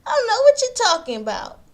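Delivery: a mock high innocent denial; playing Joyce with a high, innocent voice; Joyce denying. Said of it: "Joyce says".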